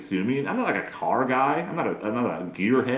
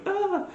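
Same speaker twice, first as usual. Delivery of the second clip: very high little "ah"; put-on high sigh of delight; mock swooning gasp. Woman hearing the lock click, high mock swoon.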